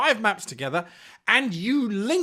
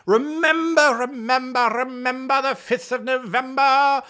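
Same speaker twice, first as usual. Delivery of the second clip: recited rhyme; 3 semitones higher, 4 dB louder than usual. Acted.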